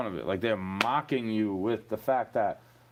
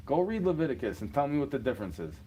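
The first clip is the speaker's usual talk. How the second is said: usual speaking voice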